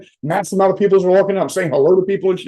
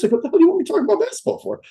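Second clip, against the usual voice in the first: exasperated protest; 9 semitones higher, faster than usual. Retold or imitated. Retelling his exasperated protest.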